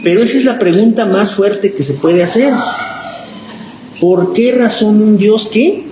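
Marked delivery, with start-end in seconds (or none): 4.0-5.8 drawn-out qué inviting the class to answer; fill-in-the-blank prompt. Shown as a demonstration. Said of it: "prompting class to complete".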